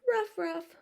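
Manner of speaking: high yappy ruff ruff; little dog barks. Acted. Pocket dogs greeting their owner with high barks. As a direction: bark it like tiny dogs. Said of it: "Biff and Buff always said".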